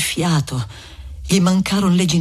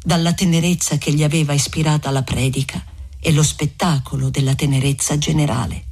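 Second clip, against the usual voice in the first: ordinary delivery, nothing marked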